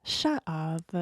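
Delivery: ordinary talk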